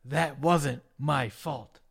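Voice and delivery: imitated slow, deep-set denial; Snitsky. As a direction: draw it out low and deliberate. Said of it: "And Snitsky says".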